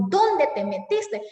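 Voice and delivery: high, slow exclamation on "Dónde"; exclaiming. Shown as a demonstration. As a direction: call out in disbelief. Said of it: "puede ser exclamación".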